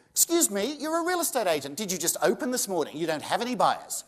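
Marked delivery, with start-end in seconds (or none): none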